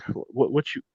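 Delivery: put-on hushed, faltering teenage self; younger self's voice. Timid teen answering a drill sergeant, hushed stammer. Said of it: "my voice is different at this point".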